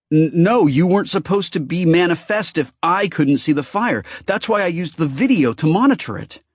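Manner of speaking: loud, high, rushed protest; panicked denial. A narrator's reading. Ben protests in alarm to the hurt goddess. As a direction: blurt it out in alarm.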